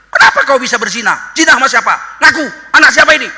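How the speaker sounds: high, loud, furious interrogation; enraged Bani Israel shouting at Mary; angry accusing crowd. Acted. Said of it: "Uh, marahnya Bani Israel".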